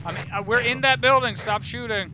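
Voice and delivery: urgent yell to cease fire; shouting. Real, not acted. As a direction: yell it out, desperate. Friendly fire, yelling to stop.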